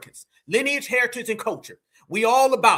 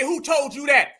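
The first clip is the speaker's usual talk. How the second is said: ordinary talk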